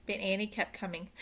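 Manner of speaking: ordinary delivery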